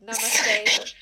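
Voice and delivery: loud greeting. Pretend.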